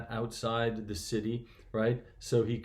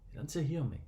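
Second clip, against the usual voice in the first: soft, lowered-volume emphasis; hushed repetition; 6 dB quieter, faster than usual. Real, not acted. Preacher repeating the point to listeners, softly. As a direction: drop the volume to let it sink in.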